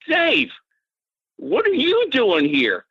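high, slow, astonished greeting; surprised. Retold or imitated. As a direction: act like you can't believe who you just ran into. Bob, surprised, greets Dave at the water.

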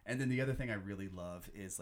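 usual speaking voice

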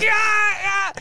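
loud, high mock death cry; hammed-up high yell of pain; imitated pained scream. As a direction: act out a scream of agony. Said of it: "And he's like".

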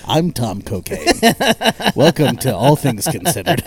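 lightly voiced public radio host; smooth mock radio anchor; NPR announcer voice. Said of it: "that N P R like announcer voice".

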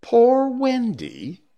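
slow, very high wail rising at the end; plaintive, rising cry; questioning moan. Acted. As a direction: draw it out high and loud, ending upward.

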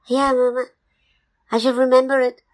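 high-pitched little cub voice; obedient baby bear. Acted. Baby bear promises mama to remember, high childlike voice. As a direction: promise like a dutiful small child. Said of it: "the baby bear said".

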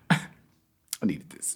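breathy whisper. Retold or imitated. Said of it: "hit him with the head to the side".

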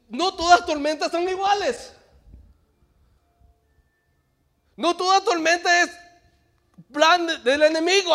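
loud, high, rising sermon delivery; fiery preaching. Acted. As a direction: preach it hard to the congregation. Preaching at full force.

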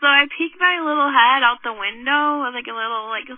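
ordinary delivery, nothing marked

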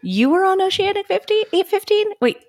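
high, puzzled questioning voice; playing baffled Jack; acted confused Jack. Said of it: "all these people that he's like".

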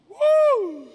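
high loud whoop of praise; joyful woo. Real, not acted. Preacher's cry.

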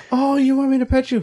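very high sweet cat voice; high mock-sweet cat. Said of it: "It's like".

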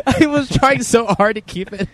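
high, loud, laughing protest. Real, not acted. Comic defends his accent attempt to co-hosts, laughing. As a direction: protest slowly through laughter.

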